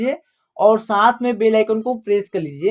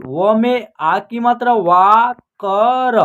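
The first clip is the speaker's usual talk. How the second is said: ordinary talk, nothing marked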